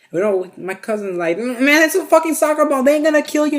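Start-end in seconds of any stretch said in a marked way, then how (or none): 1.4-3.6 high, raised-pitch protest; acted-out heated dismissal; exasperated arguing. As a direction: argue back as the irritated cousin. Cousin arguing back, acted out.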